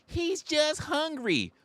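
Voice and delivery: high, slow, sugary mock voice of a gullible animal lover; mock naive bear lover. Comic mocking a naive neighbor, high and sweet. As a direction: drag it out high and sweet like a clueless fan.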